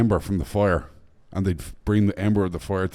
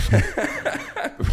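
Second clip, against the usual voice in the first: high burst of laughter; cracking up at the egg roll; real laugh. Real, not acted. Laughing at own story.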